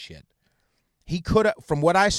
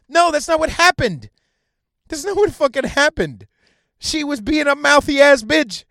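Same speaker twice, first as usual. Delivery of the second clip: higher, indignant outburst; angry rebuttal; 8 semitones higher, 4 dB louder than usual. Real, not acted. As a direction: cut in with a heated correction. Angrily rejecting the retold version.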